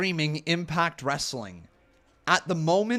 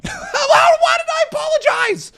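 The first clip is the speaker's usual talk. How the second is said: much higher and louder, outraged disbelief; exasperated shout; 9 dB louder than usual. Real, not acted. Streamer to his chat, realizing he was right all along, indignant shout. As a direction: yell it like you've been robbed of a win. Indignant outburst over calzone spelling.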